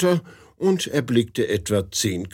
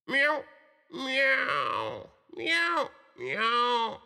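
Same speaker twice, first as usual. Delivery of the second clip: high, drawn-out tomcat miaows; cat yowling; 13 semitones higher, 5 dB quieter, much slower than usual. A narrator's reading. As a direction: wail it like a tomcat in love. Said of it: "sang mit einer ganz unnatürlichen Stimme".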